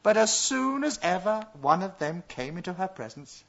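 ordinary delivery, nothing marked

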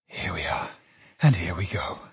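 breathless, choked near-whisper; the words barely get out, half whispered with emotion. Acted. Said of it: "choking audibly".

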